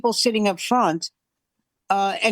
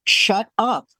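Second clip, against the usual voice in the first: very slow, spaced-out mock command; soap-opera scolding; much slower than usual. Wife to talkative husband, stern soap-opera command. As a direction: imitate a wife ordering silence. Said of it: "a soap opera".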